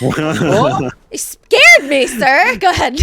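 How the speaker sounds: loud startled outburst. Real, not acted. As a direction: jump at a sudden scare. Startled by jump scare.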